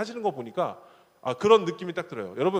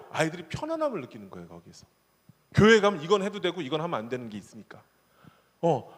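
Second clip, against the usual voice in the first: ordinary talk, nothing marked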